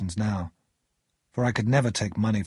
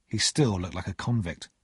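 plain reading voice

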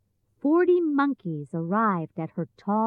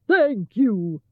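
Cowardly Lion; 4 dB louder than usual. A narrator's reading. Lion thanks his rescuers.

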